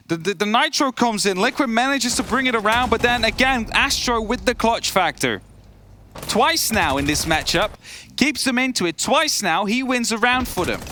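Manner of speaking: high excited analysis; esports caster. Acted. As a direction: build the tension like a caster. Hyped analysis of clutch.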